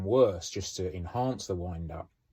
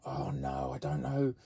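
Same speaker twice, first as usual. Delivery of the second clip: mock panic; 8 semitones higher than usual. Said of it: "everyone would be like".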